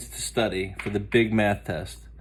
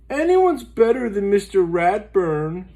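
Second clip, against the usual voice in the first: Arthur's voice; 9 semitones higher, 6 dB louder than usual. Acted. Arthur voiced, dreading teacher.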